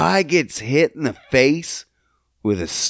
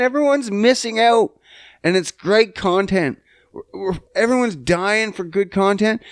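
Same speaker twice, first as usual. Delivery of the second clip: exasperated plea; 8 semitones higher than usual. Retold or imitated. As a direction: beg them in mock outrage. Acted exasperated plea to friends.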